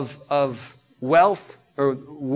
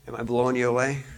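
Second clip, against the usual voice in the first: ordinary talk, nothing marked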